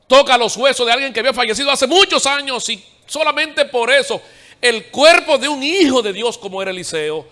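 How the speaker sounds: voz de predicador alta y aguda; prédica enérgica. Real, not acted. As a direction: proclama con fuerza, subiendo el tono. (predicación a plena voz)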